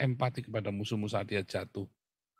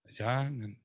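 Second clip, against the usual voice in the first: ordinary delivery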